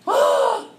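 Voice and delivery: high, loud shocked "Ah!"; startled children's gasp; mock kids' gasp. Kids react to the story.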